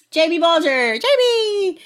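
high, loud, drawn-out cheer; excited shout-out. Real, not acted. Host cheering the next question sender, excited shout. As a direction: call the name out with rising glee.

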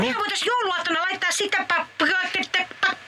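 a voice glitching on a bad connection; breaking phone line. Wife's call cutting out, repeating pa-.

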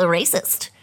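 playful mock-outraged delivery; funny voice. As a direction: say it in a silly put-on voice. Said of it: "if you say racist in that voice".

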